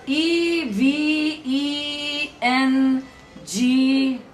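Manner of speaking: "E V E N G" very slowly, each letter sustained; spelled letter names. Shown as a demonstration. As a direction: spell out "E V E N G" in long held notes. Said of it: "How would you pronounce all the letters?".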